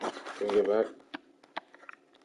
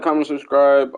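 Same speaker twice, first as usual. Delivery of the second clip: normal talking voice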